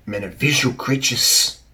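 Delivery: ordinary delivery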